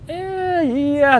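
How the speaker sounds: high, drawn-out squeaky hedge; awkwardly hedging in a strained high voice; reluctant admission. Retold or imitated. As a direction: stretch out a sheepish "eh, yeah", way up high. Reluctantly admitting money is issue.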